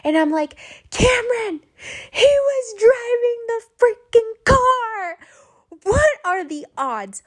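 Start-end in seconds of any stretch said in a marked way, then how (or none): 0.9-5.2 high, loud mock wail; freaking out. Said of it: "I'm freaking out". 5.8-7.2 higher-pitched exclamation of disbelief; incredulous outcry. Retold or imitated. Exclaiming over the coincidence.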